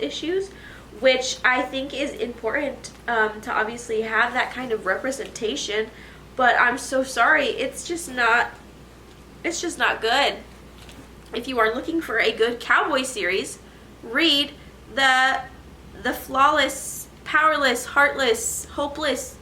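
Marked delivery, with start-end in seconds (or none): none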